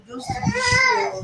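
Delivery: tearful pleading. Real, not acted. Accused of lying, pleading.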